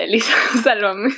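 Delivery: real laugh breaking into the words; laughing plea. Real, not acted. Speaker who lost her thread asks co-host for help, laughing. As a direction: plead for rescue while laughing.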